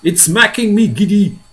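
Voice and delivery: high, loud distressed call; Miss Spider. Acted. Spider dizzy up high, calling out high. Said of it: "Miss Spider called out".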